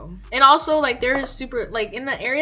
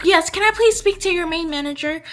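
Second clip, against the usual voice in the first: demanding mom; 7 semitones higher, 9 dB louder than usual. Retold or imitated. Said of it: "She's like".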